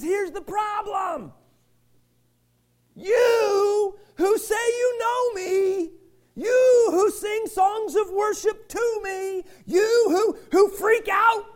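high, shouted voice of God, slow at first; God's booming rebuke. Acted. Pastor voicing God to the congregation, shouted high rebuke. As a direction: preach God's words, high and full force. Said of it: "and he says".

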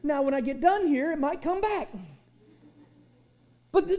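ordinary talk, nothing marked

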